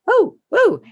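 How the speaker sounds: the dog can't wait to bolt; excited dog voice. Retold or imitated. Dog spotting an open door, high eager yelps.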